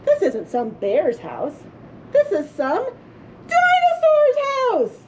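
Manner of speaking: slow, building to a high, startled dinosaur's; dawning realization. A narrator's reading. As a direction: piece it together then gasp it out. Goldilocks realizes.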